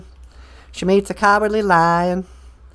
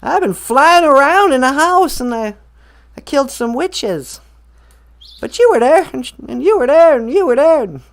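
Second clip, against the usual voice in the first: higher, louder, fast eager telling; excited rush; 6 semitones higher, 4 dB louder than usual. Retold or imitated. Dorothy waking, telling Auntie Em excitedly. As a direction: burst out with the news. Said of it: "she was like".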